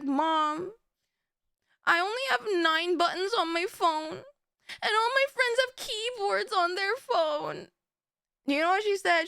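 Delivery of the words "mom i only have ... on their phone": put-on pleading teen. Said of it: "teen [name] had the audacity to be like".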